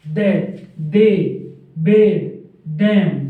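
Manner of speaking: loud, separate "दे", "दे", "बे", "देम"; pronunciation demo. Shown as a demonstration. Teacher drills the dark stroke's sound, pronunciation demo. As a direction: punch out each word with voiced "दे" sound. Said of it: "pronunciation क्या होगी इसकी?".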